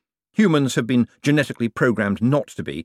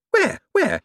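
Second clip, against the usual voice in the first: high, panicky double question; flustered alarm; 4 dB louder than usual. A narrator's reading. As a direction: scramble to find the label. Hicks searching for the label.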